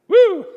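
high, loud mocking whoop. Preacher mocking Peter's offer to forgive seven times, loud whoop. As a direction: whoop as if it's a huge feat.

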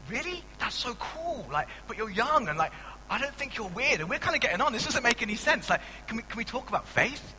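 high, gushing mock excitement; excited stranger. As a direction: play the thrilled new friend, way up in pitch. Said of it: "Sometimes they're like".

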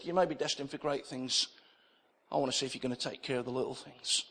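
ordinary delivery

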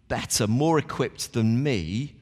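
normal talking voice

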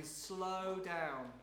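slow, stretched-out delivery; deliberately slowed down. Real, not acted. Preacher telling congregation not to rush reading, deliberately slow. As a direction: draw out SLOW and DOWN. Enacts slowing down while teaching.

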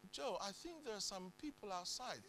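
very soft, hushed voice; hushed warning. Retold or imitated. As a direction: quietly alert someone to intruders. Wife quietly warns of intruders.

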